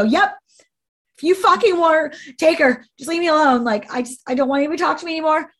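high, loud, fed-up outburst; exasperated retold shouting; retold yelling. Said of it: "screaming at the top of my lungs".

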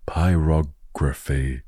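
pyrography very slow, broken as pyrog-raphy; sounding out PYROGRAPHY. Real, not acted. Podcaster struggling with a tricky word, slowly sounding out pyrography. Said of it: "if I could say it".

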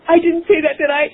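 high, shocked "I didn't say that"; aghast disbelief. Retold or imitated. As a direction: replay the horror of blurting it out. Aghast at her own words.

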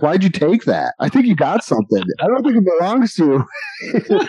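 high, fast, scolding parent tone breaking into laughter. Retold or imitated. Dave scolding the shoplifter like a parent, high and laughing. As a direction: lecture him like a parent, laughing. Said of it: "doing that, like, parent thing".

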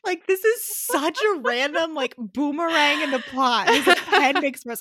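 loud, high-pitched retold incredulity; amused disbelief. Retold disbelieving reaction to plot twist.

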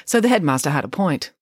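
ordinary delivery

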